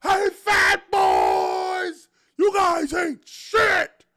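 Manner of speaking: loud, high, drawn-out heckler yelling at the linemen; heckling shout. Retold or imitated. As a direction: bellow insults from the stands like a rowdy fan. Fan heckling linemen, retold.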